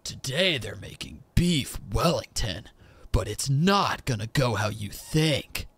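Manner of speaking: slow, dramatic TV narrator parody; mocking a cooking show announcer; mock voiceover guy. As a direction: ham up a reality-show voiceover. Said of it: "voiceover guy who's like".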